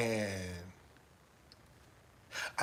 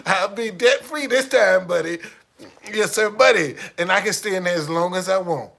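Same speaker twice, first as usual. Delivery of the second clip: gleeful; 5 semitones higher, 11 dB louder than usual. Real, not acted. Gleeful boasting.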